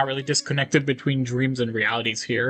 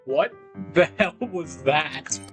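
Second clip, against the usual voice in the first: slow, drawn-out shock; stunned disbelief; 3 semitones higher, much slower than usual. Acted. Student reacts to something strange happening, stunned disbelief. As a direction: stretch it out, baffled and startled.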